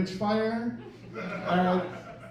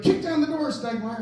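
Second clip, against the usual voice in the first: higher, louder mock command; barked order; 6 semitones higher, 6 dB louder than usual. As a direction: bark it like a squad leader at a raid. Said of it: "they'd be like".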